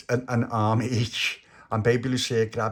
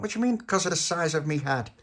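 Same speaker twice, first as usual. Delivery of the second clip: higher-pitched affronted question; offended echo; 7 semitones higher than usual. Acted. Tony to Little Pappy about his head, offended echo. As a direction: throw it back, put out.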